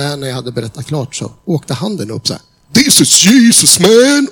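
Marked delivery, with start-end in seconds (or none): 2.7-4.3 loud, high, slow triumphant cry; joyful exclamation. Retold or imitated. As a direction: burst out in amazed joy. Boss exclaims after hearing testimony.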